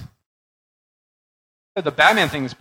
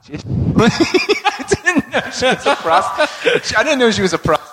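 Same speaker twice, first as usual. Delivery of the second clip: laughing; 8 semitones higher than usual. Real, not acted. Laughing at surprising comic fact.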